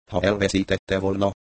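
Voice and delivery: usual speaking voice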